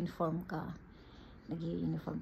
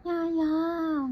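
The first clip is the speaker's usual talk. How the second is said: put-on high, loud child's call for the nanny; child calling yaya; 9 semitones higher, 8 dB louder than usual. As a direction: call out like a little kid.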